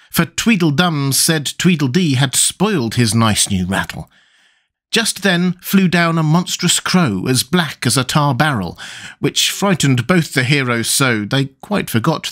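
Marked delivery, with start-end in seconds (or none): none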